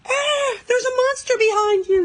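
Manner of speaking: sees a monster shadow and yells a warning to Renee in panic; frightened yell. A narrator's reading. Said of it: "yelled Christabel".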